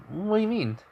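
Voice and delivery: put-on high, girlish voice; Toga's voice. Toga brushing off Deku's worry in a high girlish voice. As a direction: pitch well up for the girl. Toga denies being upset.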